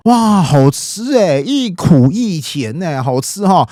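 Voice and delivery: slow, drawn-out savouring exclamation; delighted tasting. Acted. Xun Yu tastes the pastry before Cao Cao, delighted savouring. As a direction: act out relishing a bite, stretching the words.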